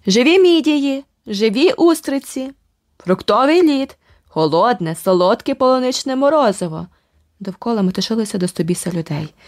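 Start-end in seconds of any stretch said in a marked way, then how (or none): 0.1-6.9 street hawkers crying out. Acted. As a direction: call the wares across the street. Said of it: "які раз по раз вигукували".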